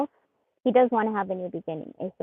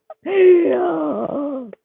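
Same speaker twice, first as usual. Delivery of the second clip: excited gasp; 5 semitones higher, 6 dB louder than usual. Real, not acted. Gasp at a card.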